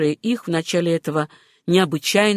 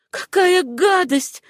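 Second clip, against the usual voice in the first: high, drawn-out revulsion; high exclamation of loathing; disgusted outburst; 11 semitones higher, slower than usual. A narrator's reading. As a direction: let the disgust burst out, slowly. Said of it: "вырвалась у неё".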